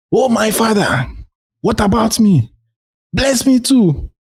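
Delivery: high, pleading cry; begging. Acted. Esau begging his father for a blessing, in a high desperate voice. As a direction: beg your father desperately. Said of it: "he begged".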